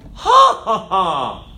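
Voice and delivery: loud high first "ha", then dropping; hammed-up evil spirit's laugh; mock demon laugh. Evil spirit reacting to Jesus, mock demon laugh.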